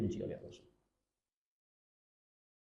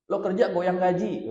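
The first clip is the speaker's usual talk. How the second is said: higher and louder sneer; mock arrogant boss; 7 semitones higher, 7 dB louder than usual. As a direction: scoff at the lowly worker. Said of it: "oh gitu ngomongnya".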